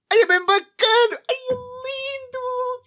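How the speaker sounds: high, slow falsetto gushing; mock swoon. Gamer gushing over his victory, high falsetto swoon. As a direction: gush like a starstruck fan.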